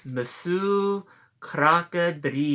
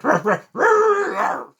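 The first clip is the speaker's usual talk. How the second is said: high, loud, slow barking; dog barks; 14 semitones higher, 8 dB louder, slower than usual. Acted. Dog taunting Alligator from the porch, loud high barks. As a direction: bark three times like a cheeky dog. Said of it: "and he barked".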